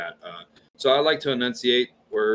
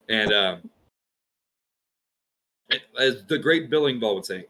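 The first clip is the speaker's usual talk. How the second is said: ordinary talk, nothing marked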